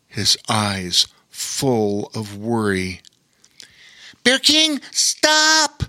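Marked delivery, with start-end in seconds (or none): 4.2-5.8 much higher, louder shout of alarm; yelled warning. Acted. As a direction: cry out to stop him in time. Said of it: "yelled Lore".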